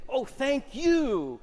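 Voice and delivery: high, slow, swooning thank you; overflowing mock gratitude; hammed-up grateful sigh; gushing thank you. Shown as a demonstration. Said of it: "return it to me and say".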